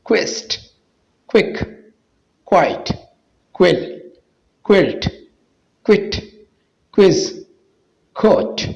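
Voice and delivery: word list with QU opening on k; QU as "kaf". Shown as a demonstration. Teacher drilling QU words for viewers, k sound first. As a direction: say each word alone, QU starting with a k sound. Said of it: "काफ का आवाज़ देता है".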